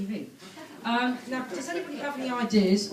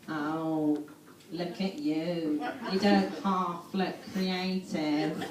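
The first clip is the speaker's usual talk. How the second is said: lightly voiced Jo Brand, lower and slow, dry and deadpan; Jo Brand impression; 4 semitones lower, 3 dB quieter, much slower than usual. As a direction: drawl it out like a bored, dry comedian. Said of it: "And she said".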